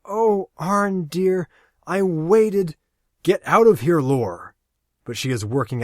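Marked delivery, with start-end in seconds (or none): 0.1-2.8 high and slow, dreamy and adoring; drawn-out, entranced woman's voice; ecstatic young woman. Acted. Lori clings to Arn, ecstatic. 3.2-4.6 loud, high command; urgent shout. Acted. Aaron orders Lori away.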